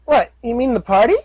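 high, chirpy filly voice; young filly voice. Acted. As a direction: talk like a cheerful little filly. Filly character voice.

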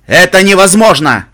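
booming declaration. Acted. Ghoul answers Calamity's complaint, booming. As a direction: declare it at full royal volume. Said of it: "объявил".

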